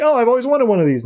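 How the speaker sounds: fast, high mock gushing; retold gushing kid. Cindy hugs Harley under the tree, gushing. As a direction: imitate a thrilled child, words rushing out. Girl hugs her new present.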